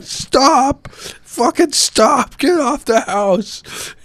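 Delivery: high-pitched, slowed furious yell; furious coach yelling. Retold or imitated. Coach catching the players wrecking the house, high furious yell. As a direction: bellow at the kids to get off the roof. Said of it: "He was so pissed".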